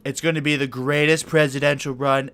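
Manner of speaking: lightly voiced Trump bluster; a hint of Trump showmanship; Trump impression. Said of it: "This is Donald J. Trump".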